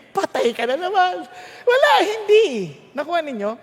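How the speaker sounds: normal talking voice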